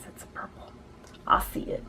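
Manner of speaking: hushed aside; whisper. Real, not acted. Telling viewers about the shade, whispered aside. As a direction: whisper it as if to yourself.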